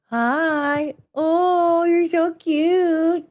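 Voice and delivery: high, slow cooing at the dog; sugary pet voice. Real, not acted. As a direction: coo at a sweet puppy.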